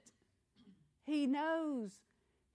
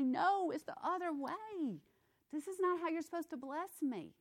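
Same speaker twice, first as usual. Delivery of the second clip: indignant believer; 8 semitones higher than usual. Pretend. Believer protesting God.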